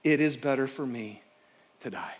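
low, soft, sinking despair; quoting Jonah's wish to die, fading to almost nothing. Retold or imitated. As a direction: let the words drain away. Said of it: "the place that Jonah got".

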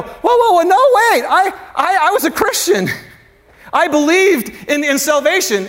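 high-pitched, stammering mock objection; alarmed protest. Some of you protesting to God at judgment, mock alarm.

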